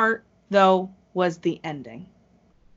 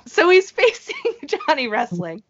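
much higher, laughing restart; 9 semitones higher than usual. Real, not acted. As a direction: giggle the matchup out again.

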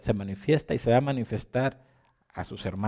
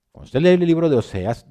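raised, higher, emphatic repeat; louder repeat; 5 semitones higher, 8 dB louder than usual. Acted. Preacher repeats to the congregation, louder and higher. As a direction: hammer the line home a second time, louder.

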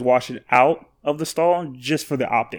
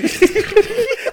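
high giggle. Retold or imitated. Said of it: "that's how she sounds when she's drunk".

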